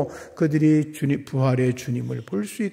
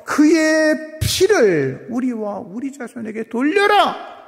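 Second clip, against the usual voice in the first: high, slow, drawn-out cry; imitated mob shout; 8 semitones higher, 6 dB louder, slower than usual. Preacher voices the crowd demanding crucifixion, imitated shout. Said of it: "유대인들이 소리질러 말했죠".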